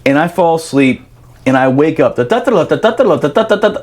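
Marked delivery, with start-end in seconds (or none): 2.2-3.8 hummed bugle call. Retold or imitated. Imitating reveille bugle.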